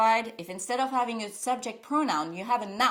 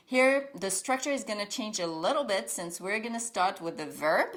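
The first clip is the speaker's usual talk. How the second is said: ordinary talk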